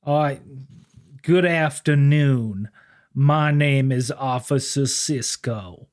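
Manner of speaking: slow, prim and formal cop voice; a humble, overly polite officer of the law; mock-gentlemanly lawman. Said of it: "it kinda went something like this".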